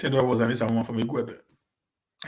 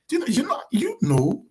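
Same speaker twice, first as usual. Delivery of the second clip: strained stammer; 10 semitones higher than usual. Real, not acted. Struggles for words, stammering.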